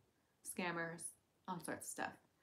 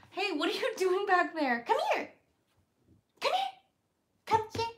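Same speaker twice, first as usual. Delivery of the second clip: calling the pet; 10 semitones higher, 10 dB louder than usual. Real, not acted. Calling the squirrel, high.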